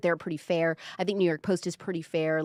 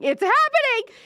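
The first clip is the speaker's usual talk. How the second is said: squealed much higher and louder; excited scream; 6 dB louder than usual. Retold or imitated. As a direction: shriek it like the best news ever. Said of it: "I actually screamed".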